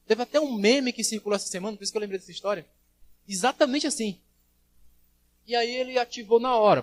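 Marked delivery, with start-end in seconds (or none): none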